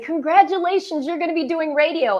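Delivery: chirpy announcement, lightly voiced; quoting the bosses. Bosses telling the new arrival she'll do radio, in a bright chirpy announcing voice.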